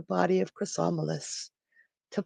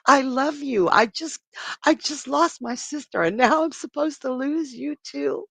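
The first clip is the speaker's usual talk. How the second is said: high, loud, anguished begging through a sob; desperate pleading; 7 semitones higher, 5 dB louder than usual. Retold or imitated. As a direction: beg him not to make you do it, near tears. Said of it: "And Phrixus is like".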